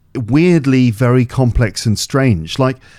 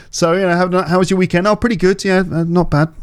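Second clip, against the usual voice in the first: awkward small talk; 6 semitones higher than usual. Retold or imitated. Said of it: "Would you be like".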